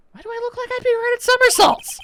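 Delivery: very high, squeaky protest; offended high-pitched question; indignant disbelief. Acted. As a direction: object in an incredulous high voice. Objects to doing somersaults.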